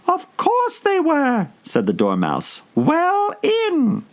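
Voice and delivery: very high, slow little dormouse squeak; a tiny creature answering after a long think; high, slow mouse voice; squeaky Dormouse. A narrator's reading.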